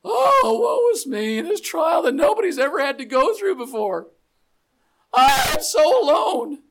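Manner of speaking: over-the-top self-pitying lament; melodramatic wailing. Pretend. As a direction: wail it like the worst trial on earth. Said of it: "we go to the first two verses here".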